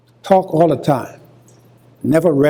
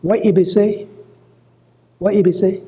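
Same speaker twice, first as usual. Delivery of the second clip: What he be say? raised high in a Gullah lilt; Gullah phrasing; 6 semitones higher than usual. Shown as a demonstration. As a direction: put on the Gullah speech pattern. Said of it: "We just use that".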